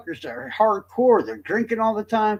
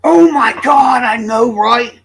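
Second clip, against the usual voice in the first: loud, higher burst of recognition; excited outburst; 5 semitones higher, 8 dB louder, slower than usual. Real, not acted. Reacting to the Richard Allen comparison with a loud excited outburst. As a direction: blurt it out, suddenly thrilled.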